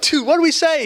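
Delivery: high-pitched incredulous question, retold; bewildered urgency. Praying friend asking weeping Adam what was said, bewildered urgency. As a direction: act out a baffled friend needing to know, voice leaping up.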